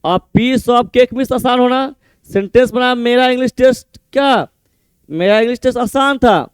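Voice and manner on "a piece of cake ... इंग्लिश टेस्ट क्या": usual speaking voice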